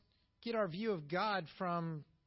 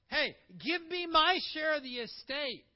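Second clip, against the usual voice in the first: demanding younger son; 8 semitones higher, 7 dB louder than usual. Retold or imitated. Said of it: "He's, he's like".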